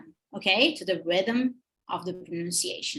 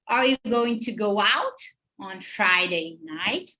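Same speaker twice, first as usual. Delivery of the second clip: slow question, GO OUT stressed, rising on NIGHT; stressed verb. Shown as a demonstration. Teacher modeling second question, stressed verb in go out. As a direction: lean on "go out" and "Friday", lift "night". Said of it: "the verb is gonna be stressed".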